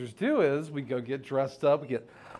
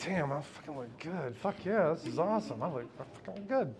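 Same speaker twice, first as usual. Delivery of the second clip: much softer, smug self-admiration to the mirror; vain murmur; 10 dB quieter than usual. Retold or imitated. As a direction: murmur it, pleased with yourself.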